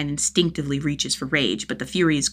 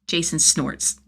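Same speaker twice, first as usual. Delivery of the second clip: usual speaking voice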